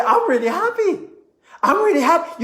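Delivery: high, beaming with a smile; smiling. Shown as a demonstration. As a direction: let the smile come through the words. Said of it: "I'm starting to smile".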